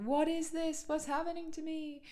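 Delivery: high, startled questions; astonished. Retold or imitated. Retelling her first light language, high astonished cry. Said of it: "I burst into tears and I was like".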